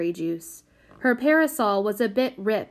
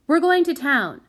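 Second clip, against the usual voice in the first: high, loud, firm statement; announcing; 6 semitones higher, 6 dB louder than usual. A narrator's reading. Prudence informing the carter, announcing. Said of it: "announced Prudence".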